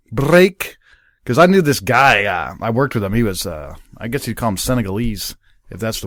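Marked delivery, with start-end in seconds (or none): 0.1-0.8 excited mock Scottish BRAKE, higher and louder; exaggerated Scottish accent. Imagining the Scottish host getting excited, exaggerated Scottish accent. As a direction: elaborate "break" with a Scottish rolled R. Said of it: "if it elaborates the b".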